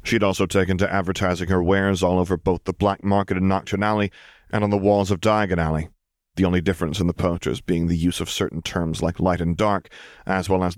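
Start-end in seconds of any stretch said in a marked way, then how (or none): none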